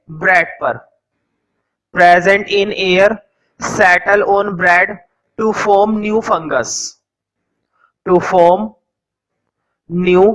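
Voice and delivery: each word spaced out slowly for note-taking; teacher dictating notes to the class slowly; slow dictation. Acted. Teacher to students copying notes, slow dictation.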